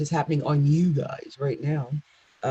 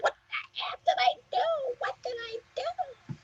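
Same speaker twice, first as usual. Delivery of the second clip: much higher, regretful wail of a newly married Cancer; panicked newlywed Cancer; 7 dB quieter than usual. Retold or imitated. Cancer regretting a rushed wedding, high panicked wail. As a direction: squeal it like you just woke up married. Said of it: "going the next day like".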